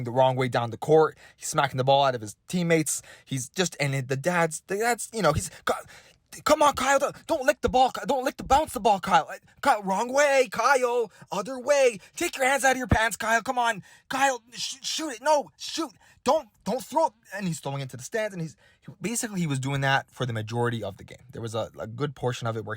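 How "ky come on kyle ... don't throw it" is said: put-on high, strained sideline dad calling to his kid; coaching dad yelling. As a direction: call out to a struggling kid from the stands.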